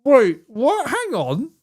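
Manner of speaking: high, startled double take; baffled. Real, not acted. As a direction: jump up in pitch in sudden confusion. Confused double take at the word.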